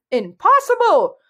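high, loud outburst; indignant interruption. A narrator's reading. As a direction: exclaim it in outraged disbelief. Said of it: "the olive green dragon interrupted".

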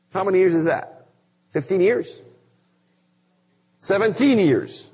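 ordinary delivery

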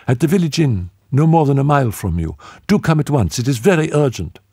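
high, fast, agitated pleading; urgent fear. Acted. Tanith begs Rex to hurry, high and agitated. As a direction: hurry him, frightened. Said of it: "urgency and agitation of extreme fear".

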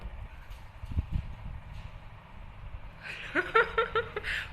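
breathy high-pitched giggle; soft airy laugh. Real, not acted. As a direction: let a real laugh bubble up high. Grandma laughing at viewers' comments.

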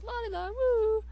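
high carefree la-di-da; carefree singsong. Acted. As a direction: sing it out without a care.

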